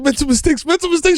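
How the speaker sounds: mocking repetition. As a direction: parrot his excuse back at him, mocking. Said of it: "I said".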